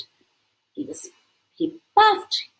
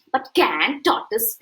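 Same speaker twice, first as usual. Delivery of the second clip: plain reading voice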